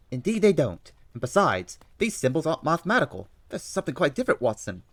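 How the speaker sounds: put-on high, loud London-accented Holmes; eager detective reading the news aloud. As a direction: play Holmes, keen and bright over the paper.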